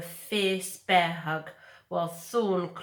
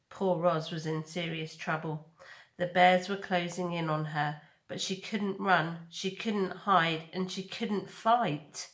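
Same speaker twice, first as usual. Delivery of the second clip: ordinary delivery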